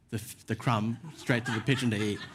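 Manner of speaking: usual speaking voice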